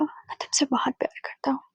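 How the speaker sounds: soft breathy whisper; inner thought whispered. A narrator's reading. Husband silently tells his wife he loves her, whispered. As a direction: hush it like a thought to yourself. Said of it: "उसने मन में कहा".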